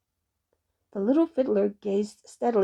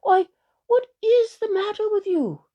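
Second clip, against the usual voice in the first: high, loud, puzzled partner; quick concerned question; 12 semitones higher, 6 dB louder than usual. A narrator's reading. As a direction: ask with urgent concern, pitched up high. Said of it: "his partner asked quickly".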